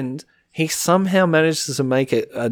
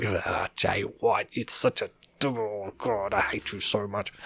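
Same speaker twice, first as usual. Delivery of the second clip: slow, much softer grumbling hatred; mock hateful grumble; 3 semitones lower, 10 dB quieter, slower than usual. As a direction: growl it low like you begrudge him. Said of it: "and I'm like".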